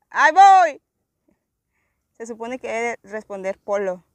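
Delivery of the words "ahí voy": high, loud shouted answer; calling out. Real, not acted. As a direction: holler back to someone far away.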